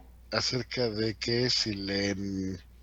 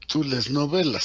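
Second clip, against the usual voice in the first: tú lees novelas with a lifted, louder tú; translation demo; 5 semitones higher, 7 dB louder than usual. Shown as a demonstration. Teacher models a literal translation. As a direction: push tú lees novelas out clearly. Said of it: "una traducción".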